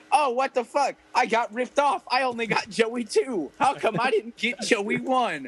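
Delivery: lightly voiced whiny complaint of a ripped-off kid; imagined disappointed kid. Host imagines kid finding his name on a cartridge, mock indignant.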